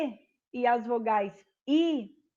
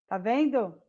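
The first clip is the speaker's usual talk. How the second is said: usual speaking voice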